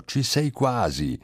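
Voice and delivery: high, slow call from the back of the room; calling out across the club, pitched high; woman in the audience calls to the pianist, a mock high shout; retold shout. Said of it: "gridò".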